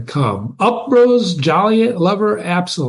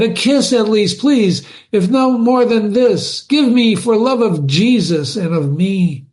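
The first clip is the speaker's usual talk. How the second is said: pleading lover; 7 semitones higher than usual. Acted. Absalom begs for kiss.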